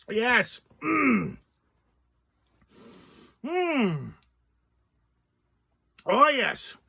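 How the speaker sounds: strained hums through a sour mouthful; eating sour candy. Real, not acted. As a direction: hum through the sour bite. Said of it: "That's sour".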